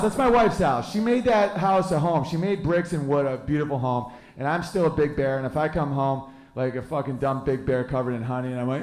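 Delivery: ordinary delivery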